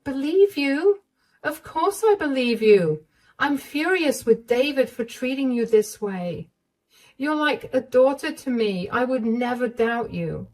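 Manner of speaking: caring mother-in-law. Acted. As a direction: comfort her like a worried mom.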